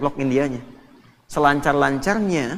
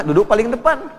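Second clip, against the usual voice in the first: louder, higher call to sit at the front; emphatic instruction; 7 semitones higher, 6 dB louder than usual. Acted. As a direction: press the point on depan.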